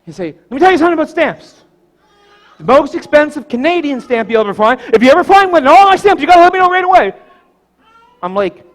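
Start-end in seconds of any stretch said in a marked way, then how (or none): 0.3-7.1 high, quick, emphatic grandpa, acted out; excited instruction. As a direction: urge the kid, building louder.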